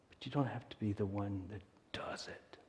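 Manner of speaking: hushed whisper. Real, not acted. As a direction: lean in and whisper it. Hushed point in sermon.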